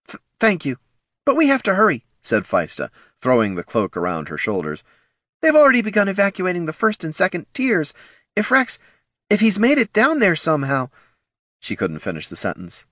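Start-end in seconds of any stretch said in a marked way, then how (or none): 0.1-2.0 flustered. A narrator's reading. Flustered mother. 5.4-10.9 anxious mother. A narrator's reading. Worried mother.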